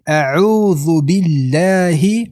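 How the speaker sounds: slow 'اعوذ بالله' with full final hi; clearly sounded hi. Shown as a demonstration. Teacher warns against dropping the h, says it slowly. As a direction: recite 'اعوذ بالله' without swallowing the hi.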